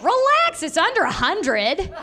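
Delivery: nephew reassuring. Retold or imitated.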